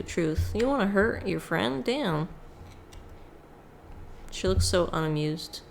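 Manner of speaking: usual speaking voice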